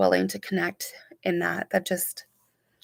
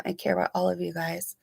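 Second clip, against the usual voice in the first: ordinary delivery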